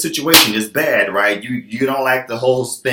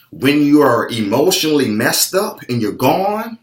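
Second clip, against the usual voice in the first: ordinary talk, nothing marked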